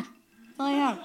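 laughing as a sheepish echo. Real, not acted. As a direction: repeat it quietly, still laughing. Repeats it, softer, still laughing.

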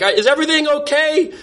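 high-pitched call toward another room; retold call to his date in the other room; calling out to her. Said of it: "I try shouting to her".

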